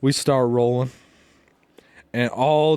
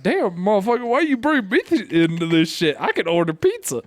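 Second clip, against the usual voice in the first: high-pitched exasperated complaint; retold indignant protest; 5 semitones higher than usual. Friend retelling his protest at being dragged in, indignant. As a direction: complain in a raised, incredulous pitch.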